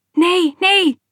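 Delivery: high, loud cry to stop him; mother's urgent shouted protest. A narrator's reading. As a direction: call out in alarm, voice jumping up. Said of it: "riep mijn moeder".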